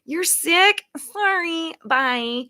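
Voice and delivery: high, loud, slow fake-polite brush-off; mock clinic staff. Mimicking staff sending a sick patient home, sing-song and slow. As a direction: play a chirpy receptionist turning her away. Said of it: "get to Seattle and say".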